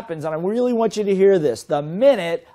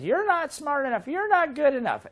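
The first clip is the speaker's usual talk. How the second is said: much higher, belittling inner voice; nagging inner voice; 10 semitones higher than usual. Retold or imitated. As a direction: sneer it like the voice of self-doubt. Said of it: "the little voice says".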